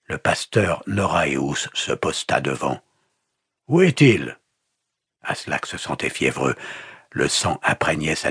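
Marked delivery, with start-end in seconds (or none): 3.7-4.4 sharp, high, loud question; angry demand. A narrator's reading. Pastor interrogating the wounded captive, angry demand. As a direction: demand an answer loudly.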